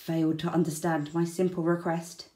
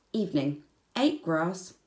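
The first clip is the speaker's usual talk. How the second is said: ordinary delivery, nothing marked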